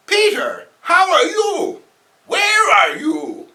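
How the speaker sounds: very high, slow fretting aunt; high worried aunt calling out; worried old aunt. Acted. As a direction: fret over your nephew.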